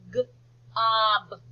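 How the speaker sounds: G-OB broken into its separate sounds; phonics-style G-OB, sound by sound. Shown as a demonstration. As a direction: sound out G-OB, the G cut off from the OB. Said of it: "Try spelling it".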